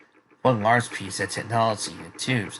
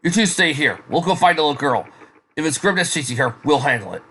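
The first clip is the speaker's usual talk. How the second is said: louder, higher, taking charge; urgent commanding; 5 semitones higher, 5 dB louder than usual. Acted. Team ordering soldiers to stay during rescue, urgent command. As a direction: give firm orders with urgency.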